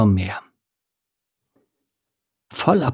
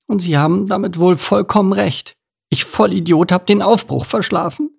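small fish voice; 9 semitones higher than usual. Acted. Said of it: "dachte er verzweifelt".

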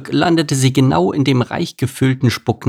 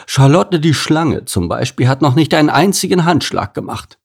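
indignant horse; 6 semitones higher than usual. Acted. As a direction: complain about the lazy snake. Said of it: "bestätigte Anton".

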